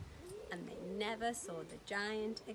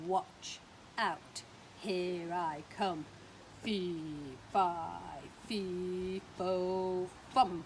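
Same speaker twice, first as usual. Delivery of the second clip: slow booming giant chant; much slower than usual. Acted. Storyteller playing the giant, booming at Jack. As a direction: stomp it out like a big giant.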